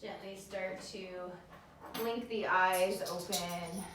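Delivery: soft guided relaxation voice; soothing yoga teacher. Acted. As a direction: ease them gently.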